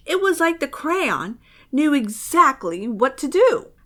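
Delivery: plain reading voice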